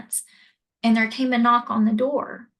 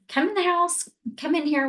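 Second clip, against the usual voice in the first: higher-pitched, concerned woman ushering someone in; concerned urging; 7 semitones higher, faster than usual. Retold or imitated. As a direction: usher a child in, voice lifted. Urgent welcome of child in story.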